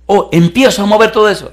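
high, loud eager burst; retold excitement. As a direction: imitate a man thrilled to get started. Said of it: "me dice él emocionado".

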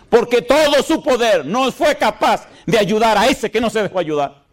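tono agudo y enfático de predicador; prédica enérgica. Real, not acted. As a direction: predica con fervor, subiendo mucho la voz de tono. (prédica fervorosa en español)

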